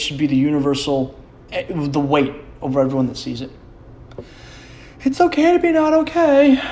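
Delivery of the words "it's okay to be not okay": very high, sing-song mock sincerity; sarcastic high sing-song version of the mental-health slogan; mock sincere slogan. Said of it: "you have to do now".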